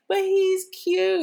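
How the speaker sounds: high, loud, stretched-out whine; pleading mock protest. Her protest in retold argument.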